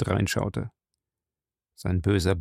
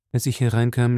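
ordinary delivery

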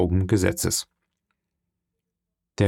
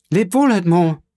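higher, louder desperate farewell cry; calling out farewell; 8 semitones higher, 5 dB louder than usual. A narrator's reading. Mercedes at the window crying farewell to Edmond, loud and high. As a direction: cry it after the departing carriage. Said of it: "rief Mercedes ans Fenster stürzend".

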